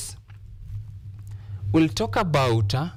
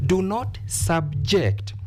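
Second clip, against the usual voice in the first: subject as a verb, split into sub-JECT; stress on second syllable; 3 semitones higher, slower than usual. Shown as a demonstration. Teacher showing the verb, subject stressed on its second syllable. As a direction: hold back, then land on JECT in subject. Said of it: "used as, uh, a verb. Therefore, we stress the second syllable".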